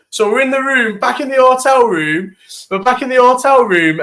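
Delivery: high, eager build-up; excited storytelling. Real, not acted. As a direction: hype the story up.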